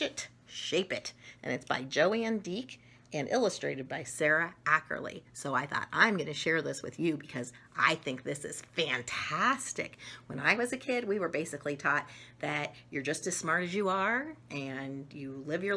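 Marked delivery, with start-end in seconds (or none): none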